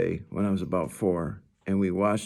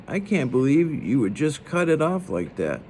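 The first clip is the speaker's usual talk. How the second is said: snotty; 6 semitones higher than usual. A narrator's reading. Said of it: "she said snotingly".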